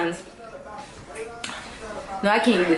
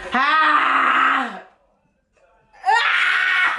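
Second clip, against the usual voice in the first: pained scream, higher and crying; real screaming; 5 semitones higher than usual. Real, not acted. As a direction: scream as the spice burns. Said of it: "Stop fucking screaming".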